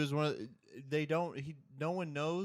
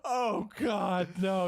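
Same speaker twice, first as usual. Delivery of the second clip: loud, high comic wail; hammed-up tearful lament; mock crying; 6 semitones higher, 7 dB louder, much slower than usual. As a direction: cry out in exaggerated anguish. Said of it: "he starts crying".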